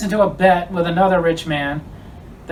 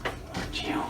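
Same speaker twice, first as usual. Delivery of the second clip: menacing whisper; 15 dB quieter than usual. Acted. Menacing threat in comedy sketch.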